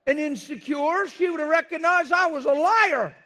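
loud, high preaching shout; full-force preaching. Real, not acted. Pastor preaching hard to the church, loud and high. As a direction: drive it home to the congregation at full volume.

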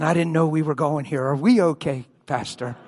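ordinary talk, nothing marked

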